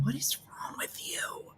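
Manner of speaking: breathy unvoiced what is wrong with you; hushed, exasperated whisper at a friend; whispered exasperation. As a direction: hiss it in disbelief. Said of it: "me going".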